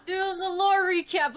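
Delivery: high, loud excited call; hyped announcement. Pretend. Friend kicking off the lore recap, excited shout. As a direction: launch into it like a hype intro.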